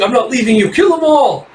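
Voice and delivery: high, loud screaming command; frantic shriek. Acted. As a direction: shriek it, losing control. Character screams order in battle.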